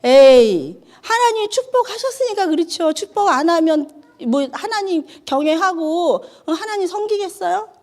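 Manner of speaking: Satan scoffing back at God, pitched high and sly; mocking Satan. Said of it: "사단이 뭐라 그래요?".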